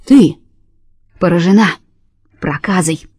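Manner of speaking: a mild stern booming verdict; booming curse. A narrator's reading. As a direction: strike her with the words. God declares leprosy curse.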